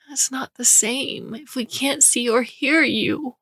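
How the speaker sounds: high, slow tearful whisper; crying whisper. A narrator's reading. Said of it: "Karina was crying".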